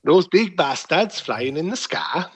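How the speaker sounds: slow Northern impression slipping into Welsh; drawn-out, botched accent that lands in Welsh; accidental Welsh accent. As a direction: attempt Northern English and drift into Welsh. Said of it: "you moving to Wales".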